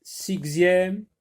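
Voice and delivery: sixième with a hard ks sound; wrong x sound. Shown as a demonstration. Teacher showing a wrong pronunciation to her class. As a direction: say sixième as sik-zième, the wrong way. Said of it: "Est-ce qu'on va dire".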